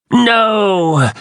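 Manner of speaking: high, sharp cry of refusal; a desperate, piercing "No!". A narrator's reading. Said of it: "the Yeerk in his head cried".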